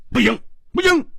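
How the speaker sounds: a startled outburst of refusal; sudden alarm. Acted. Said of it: "忽然跳起来说". (猛地跳起来，高声急喊)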